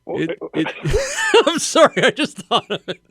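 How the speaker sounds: laughing too hard to speak; breaking up into laughter. Real, not acted. Cracking up at own joke.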